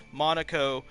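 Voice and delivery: ordinary talk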